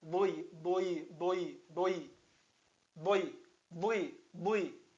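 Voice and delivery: dui repeated, the Arabic Dad with kasra; Arabic syllable demo. Shown as a demonstration. Teacher repeating dui for students to copy. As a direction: model dui again and again. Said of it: "É assim".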